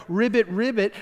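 frog croak. Retold or imitated. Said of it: "I don't think it's like".

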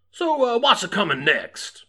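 playful attempt at a Western accent; bad cowboy accent. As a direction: put on an unconvincing cowboy twang. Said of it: "you're not good at that".

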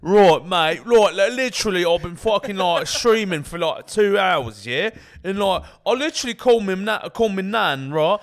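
high, ranting British lad; mock British accent. Lad moans about streaming and his nan, mock British accent. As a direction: open loud in a hammed-up British accent. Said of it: "it's an easy impression".

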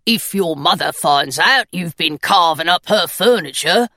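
a grumpy little stone gargoyle agreeing. Acted. Gargoyle speaking.

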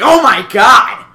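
high, loud, slow gasp of shock; mock amazement. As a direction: shout it slowly in pretend astonishment. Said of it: "they'd be like".

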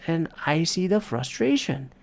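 normal talking voice

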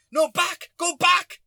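high, loud barked command; imitated shouted order. Stranger telling driver to reverse, imitated loud shout. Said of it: "telling me to go back".

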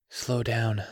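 out of breath, soft; breathless and hushed; panting. A narrator's reading. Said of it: "Grant panted".

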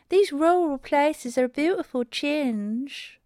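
high, slow put-on voice of a mother; Goldilocks' mother. A narrator's reading. Goldilocks' mother remarks on the countryside, high slow muttering. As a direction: draw it out high and affected. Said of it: "muttered Goldilocks' mother".

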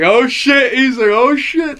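loud, high hyped-up exclamation; all excited. Retold or imitated. Rapping kid hearing the song come on, all excited. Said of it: "he was all excited".

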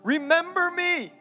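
high, slow, drawn-out plea; pleading Joseph. Retold or imitated. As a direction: beg him not to forget you. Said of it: "Joseph says to the guy".

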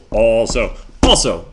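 Also split into two punched beats, loud; syllable demo. Shown as a demonstration. To listeners, proving Also has two syllables, loud and punched. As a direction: clap out each syllable of Also. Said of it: "I say it one, look, you clap it".

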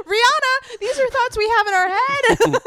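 high and loud through real laughter; delighted laughing outburst at the joke; laughing shout. Real, not acted. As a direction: crack up while getting the words out. Laughing at [name]'s burn.

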